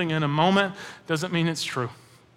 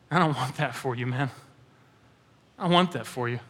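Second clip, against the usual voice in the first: tender; 6 semitones lower, 6 dB quieter than usual. Real, not acted. Tender plea to the congregation.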